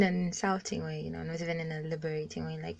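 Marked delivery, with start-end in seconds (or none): none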